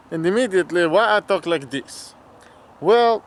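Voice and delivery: put-on jokey Russian accent with broken English; lightly hammed Russian accent; mock Russian accent. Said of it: "we just throw in the Russian accent".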